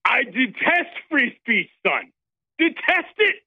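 slow, high, hammed-up fake accent; Sebastian Gorka. Caller as Gorka answers host on InfoWars, slow fake-accent villain. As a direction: declare it as a pompous villain. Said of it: "Well, Seb, how does it feel".

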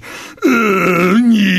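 mock Texas drawl, drawn out; fake Texas accent. Host mocking the governor at a press conference, fake Texas accent. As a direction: imitate a politician's put-on Texas accent. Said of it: "puts on a fake Texas accent".

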